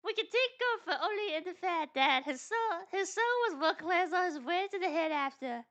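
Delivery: put-on high-pitched amateur British accent; attempted British accent. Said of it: "Can I do a British accent?".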